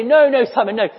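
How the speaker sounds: high fussy no no Simon; mock reassurance. Preacher mocks what Jesus never said, fussy. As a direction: brush it off in a hammed-up fuss.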